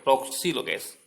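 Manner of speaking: Roksi said loud, with the R sharply articulated; over enunciating. Shown as a demonstration. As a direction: Roksi stressed, each syllable clear. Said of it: "dengar baik".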